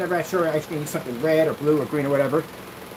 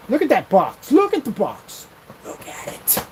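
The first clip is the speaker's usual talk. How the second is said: higher, louder, ending in a hush; mock hype; 5 semitones higher, 4 dB louder than usual. Showing off the cardboard box to the viewers in a mock hype voice.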